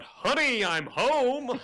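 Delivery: high, loud cheery call; yell out. Acted. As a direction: announce yourself bursting through the doors. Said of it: "I just yell out".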